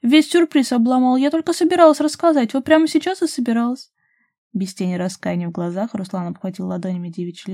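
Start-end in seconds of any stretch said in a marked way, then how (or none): 0.0-3.9 high, loud protest; indignant complaint. A narrator's reading. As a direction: complain heatedly about the spoiled surprise. Flaring up over a spoiled surprise. (громкое возмущение)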